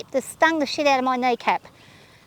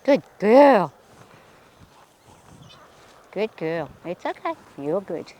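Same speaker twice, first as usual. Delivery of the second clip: calm her down gently; soothing; 7 semitones lower, slower than usual. Real, not acted. Soothing the horse while riding.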